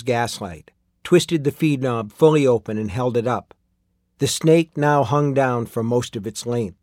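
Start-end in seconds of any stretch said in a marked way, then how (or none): none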